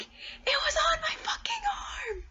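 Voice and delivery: high, loud panicked shriek; freaked-out shout. Retold or imitated. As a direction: shriek it like a spider is on you. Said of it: "I'm like".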